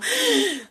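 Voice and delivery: sharp high gasp of agitation; anxious gasp. Shown as a demonstration. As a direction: gasp like you're overwhelmed. Demoing anxious speech. (suspiro agoniado e agudo)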